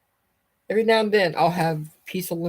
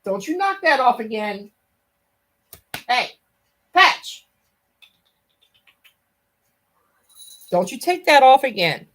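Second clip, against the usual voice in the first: higher, louder warning to a pet; sharp pet scolding, raised and loud; scolding the cat; 7 semitones higher, 5 dB louder than usual. Real, not acted. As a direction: tell the cat off firmly.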